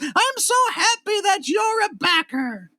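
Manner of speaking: very high, slow, sweet and gushing; Mister Rogers voice. Retold or imitated. Host thanking a backer in a high slow Mister Rogers voice. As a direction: croon the thanks like a kids' show host. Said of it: "that voice was amazing ... Mister Rogers".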